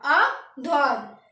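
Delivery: आ ध्वात drawn out slowly, aa dhvaat; French à droite. Shown as a demonstration. Teacher models the French word for right, slowly. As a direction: split आ ध्वात into two slow parts. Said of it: "means हो गया हमारा ध्वात".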